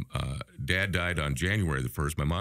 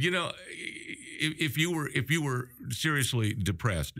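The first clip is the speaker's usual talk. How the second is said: lightly voiced higher, slow doctor; a hint of a patient physician; sponsor's voice; 7 semitones higher, slower than usual. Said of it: "and he said".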